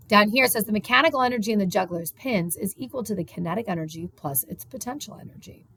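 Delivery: normal talking voice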